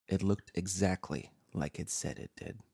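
ordinary delivery

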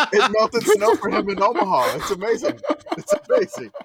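high, loud, laughing delight. Real, not acted. Host recalling a friend's seasoning snow melt, laughing. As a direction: crack up while marvelling at it.

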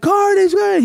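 high mock crowd call; imitated crowd yell. Everybody in the audience reacts, imitated high yell. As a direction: yelp the name like a crowd. Crowd yelling his name, retold.

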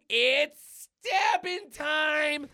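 high, drawn-out battle cry; hammed-up superhero catchphrase; gleeful catchphrase. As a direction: stretch it out loud and high. Deadpool's catchphrase.

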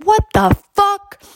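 high, loud, slow "what the fuck"; outraged yell. Retold or imitated. Brother in the car yelling at the smell, outraged. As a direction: yell it in shock at the stink.

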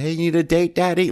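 high, teasing call to a passing car; imitating a prostitute's come-on. Prostitute calling out to a passing car, high and teasing. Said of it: "you hear one of the prostitutes".

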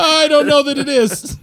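laughing. Real, not acted. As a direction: laugh it out, slowly. Laughing rebuttal.

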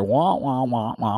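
muffled trombone wah-wah drone; Charlie Brown teacher. Retold or imitated. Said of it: "Charlie Brown's teacher".

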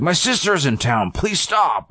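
mock exasperated plea, climbing high; super angry. Comic begs laughing crowd, high mock outrage. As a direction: beg the hecklers, pitch rising sharply. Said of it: "he's super angry".

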